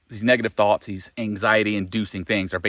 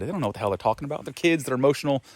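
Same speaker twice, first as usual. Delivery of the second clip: ordinary talk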